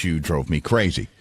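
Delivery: ordinary delivery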